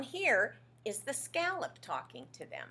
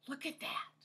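whispering; 6 semitones higher, 6 dB quieter than usual. Real, not acted. Reader aside to child.

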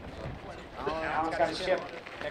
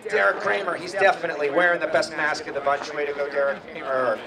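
normal talking voice

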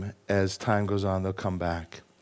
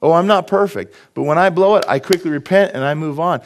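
eager confession; 5 semitones higher, 5 dB louder than usual. Pretend. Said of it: "Wouldn't you like to be able to say that".

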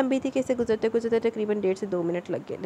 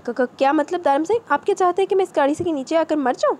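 stammering, higher and louder in shock; stunned disbelief; 6 semitones higher, 4 dB louder than usual. A narrator's reading. Wife asks if he wants her dead, stammering in shock. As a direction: sputter it out, unable to believe him. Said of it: "وہ حیرانگی سے پوچھنے لگی".

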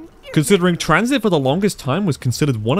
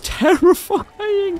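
scared laughter; much slower than usual. Real, not acted.

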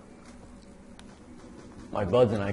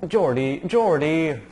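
loud, rising call to a teammate; 6 semitones higher, 8 dB louder, slower than usual. Real, not acted. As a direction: shout the name across the map. Calling teammate in game.

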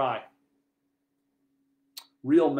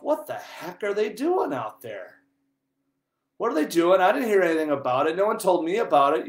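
high, loud, agitated protest; reliving an angry inner rant, voice raised; retold indignation; 7 semitones higher, 9 dB louder than usual. Priest seeing men dig up his campus, indignant retold rant. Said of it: "The anger was coming out".

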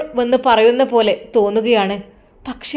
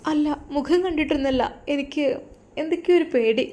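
higher, soft and timid pleading; lightly voiced scared bride, higher and soft; frightened young wife; 3 semitones higher, 6 dB quieter, faster than usual. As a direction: plead softly, scared of him.

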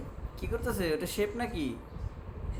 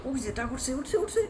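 much higher, delighted; excited cheering; 8 semitones higher than usual. Real, not acted. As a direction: cheer the duck on like a game. Cheering duck rising on screen.

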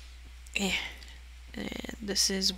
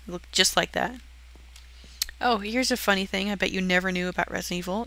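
usual speaking voice

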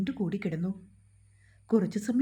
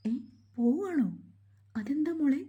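higher-pitched, taken-aback questions; startled disbelief; 6 semitones higher than usual. A narrator's reading. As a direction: react with mild shock at the news. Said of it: "ഞെട്ടിത്തിരിഞ്ഞു നോക്കി". (അമ്പരപ്പോടെ ഉയർന്ന സ്വരത്തിൽ)

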